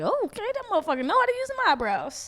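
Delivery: quick amused high exclaim; impressed exclamation. Retold or imitated. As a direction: rattle it off, impressed. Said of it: "I was like".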